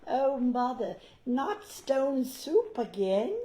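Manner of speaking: put-on high, slow childish whine; Tiny Tim complaining. Retelling his Tiny Tim line onstage, high whining child voice. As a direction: whine like a little boy sick of soup. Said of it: "I go".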